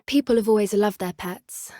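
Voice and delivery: normal talking voice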